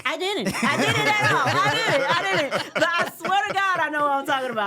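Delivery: loud, high, laughing denial; mock-indignant protest. Real, not acted. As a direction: protest loudly through laughter. Teased about misspelling, laughing protest.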